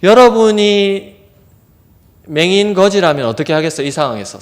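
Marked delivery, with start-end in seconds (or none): none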